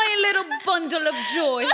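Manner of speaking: playful, doting mock British; British accent. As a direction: coo it in hammed-up British English. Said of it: "pero British accent siya".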